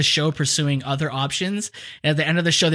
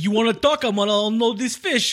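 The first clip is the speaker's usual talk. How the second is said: busy dock stevedore; 6 semitones higher than usual. Acted. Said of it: "the stevedore at the docks".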